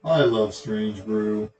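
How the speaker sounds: ordinary delivery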